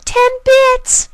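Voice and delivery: very high, loud filly shout. Acted. Filly shouting the stakes at her brother, very high and loud. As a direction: yell it at him, pointing. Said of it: "she shouted".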